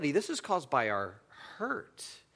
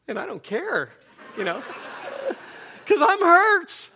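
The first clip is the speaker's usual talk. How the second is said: stubborn hurt pride; 13 semitones higher, 6 dB louder than usual. Retold or imitated. Pastor mocks his stubborn hurt self.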